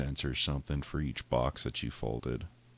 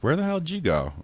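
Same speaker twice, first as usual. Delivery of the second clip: high, loud surprised question; surprised call; 13 semitones higher, 6 dB louder than usual. Retold or imitated. Asking returning patients where they went, high and surprised.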